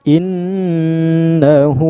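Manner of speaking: "Innahu" chanted slow, long nn and long final u; two-count long ending. Shown as a demonstration. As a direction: hold the hu of "Innahu" two beats. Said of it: "panjang dua harokat".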